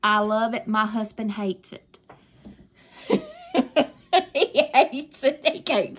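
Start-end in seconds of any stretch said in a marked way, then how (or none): 3.1-3.9 high, giggly burst of laughter; amused laugh. Real, not acted. Amused that husband hates the film. 4.3-6.0 amused, words bubbling out with laughter; laughing. Real, not acted. Laughing about husband's dislike.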